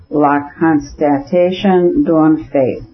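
slow, deliberate French "la constatation d'un fait"; French phrase. A narrator's reading. French phrase in husband's speech.

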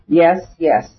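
usual speaking voice

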